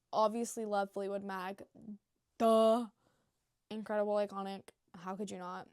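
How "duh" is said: loud, blunt duh; emphatic duh. Real, not acted. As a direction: toss off an obvious duh. Obvious answer.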